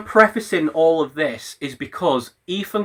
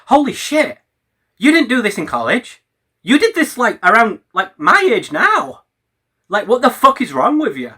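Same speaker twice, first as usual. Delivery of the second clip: pitched up in disbelief, higher; exasperated, rising to a near-shout of disbelief; incredulous outburst; 5 semitones higher than usual. Retold or imitated. Addressing Ethan, aghast at his behaviour, raised pitch. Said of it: "look back on Ethan's behaviour and think".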